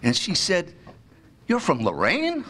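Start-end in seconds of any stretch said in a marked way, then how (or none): 1.5-2.4 higher-pitched retold disbelief; mock incredulous question. Wife learning his small hometown, in acted disbelief. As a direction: question it as if astonished, for comic effect. Acts out wife's astonished reply.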